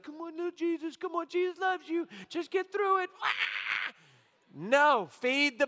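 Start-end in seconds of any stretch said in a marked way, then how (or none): 0.0-3.1 put-on much higher, sing-song coaxing of a fasting baby; hammed-up parent urging a baby to keep fasting; building to a mock shout at the end. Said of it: "be like". 3.2-3.9 high, breathless yelp; comic frustrated gasp; mock exasperated cry. Mock parent coaxing a baby.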